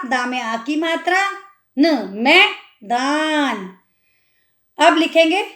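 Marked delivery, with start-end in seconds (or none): 1.8-3.8 मैदान stretched out, very slowly; slow sounding out. Shown as a demonstration. Teacher spelling out मैदान. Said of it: "पहले हम लिखेंगे मैं".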